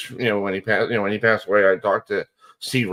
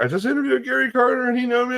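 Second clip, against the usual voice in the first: much higher, giddy fanboy rush; excited squeal. Retold or imitated. Fan phoning his brother after meeting his idol, squealing with excitement. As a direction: gush to his brother like a starstruck kid. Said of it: "on the phone screaming at him".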